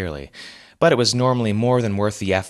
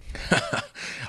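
put-on high wheezing old man's chuckle; wheezy chuckle; 8 dB quieter than usual. A narrator's reading. Old superhero chuckles with glee, wheezy. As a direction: wheeze a gleeful laugh. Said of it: "chuckled wheezily".

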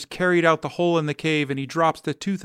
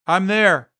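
higher, louder shout down the wall; calling down; 5 semitones higher, 7 dB louder than usual. A narrator's reading. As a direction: call down that you made it. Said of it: "Sam calls down to Oscar".

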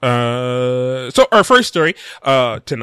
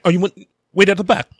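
put-on British accent; 7 semitones higher, 3 dB louder, faster than usual. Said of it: "Is that your British accent?".

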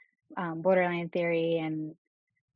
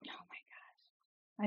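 whispering; 17 dB quieter than usual. Real, not acted. Overwhelmed by the question.

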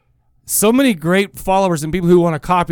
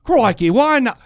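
higher-pitched mock Australian; Australian accent; 6 semitones higher, slower than usual. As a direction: put on a quick jokey Australian accent. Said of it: "He's Australian now".

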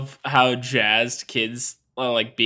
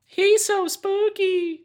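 high, slow, spooky voice; much slower than usual. Acted. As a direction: draw it out like a haunted ghost. Spooky podcast intro bit.

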